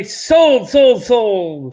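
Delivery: drawn-out announcing sold; auction close. Acted. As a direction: call out the final sale. Closing the auction.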